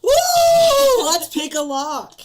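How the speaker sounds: high, loud whoop; excited cheer. Real, not acted. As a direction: cheer it out wildly. Player cheering for rogue.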